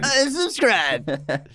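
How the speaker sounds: high, very slow mock verdict; drawn-out playful echo. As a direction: stretch it out teasingly.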